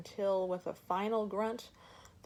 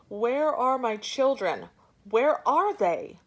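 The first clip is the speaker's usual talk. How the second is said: louder and higher, frantic yell; 6 semitones higher, 11 dB louder than usual. Acted. Mother waving her knife yells at the elephant. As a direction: yell it while rushing at him. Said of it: "waving her knife and yelling".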